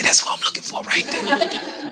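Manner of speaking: slow, secretive stage whisper; whispered aside. Retold or imitated. Inmate spotting the man he is looking for, whispered aside.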